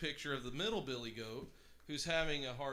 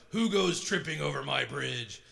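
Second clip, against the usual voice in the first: loud gruff troll bellow; a menacing troll shout from under the bridge; 8 dB louder than usual. A narrator's reading. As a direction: boom it out like a wicked troll. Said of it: "the wicked troll shroud-, shouted".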